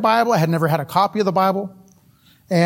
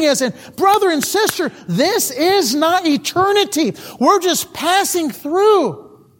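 emphatic preaching; 7 semitones higher than usual. Real, not acted. Preacher urging the congregation that this world is passing, emphatic. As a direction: press each phrase home, pitched up.

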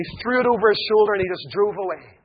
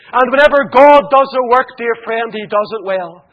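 loud preaching refrain; 4 semitones higher, 8 dB louder than usual. Acted. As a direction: declaim it loud to the gallery. Preached refrain, then repeated softly.

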